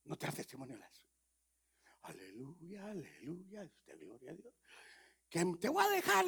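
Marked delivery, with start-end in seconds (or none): 2.0-4.5 lightly voiced churchy woman, soft; a hint of a sing-song church lady; pious woman. Preacher voicing a churchgoing wife, soft and sing-song. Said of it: "dice la mujer". 5.3-6.3 high, loud shrieking wife; wife's outburst. Retold or imitated. Wife shrieking at her husband that she is leaving. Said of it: "dice la mujer".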